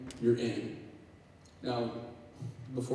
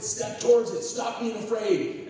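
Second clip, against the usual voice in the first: coaches yelling; 11 semitones higher, slower than usual. Retold or imitated. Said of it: "they're yelling at him the whole time".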